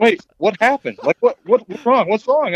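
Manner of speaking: put-on high, startled girlfriend asking what's wrong; alarmed girlfriend. Hunter's girlfriend jolted by his shouting, alarmed and high. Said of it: "she's like".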